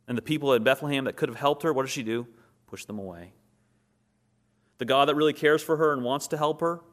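rising fervent preacher; fervent preaching. Acted. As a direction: drive the point home to the congregation. Preaching at full force.

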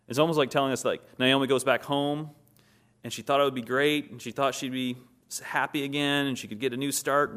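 rising fervent preacher; fervent preaching. Acted. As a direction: drive the point home to the congregation. Preaching at full force.

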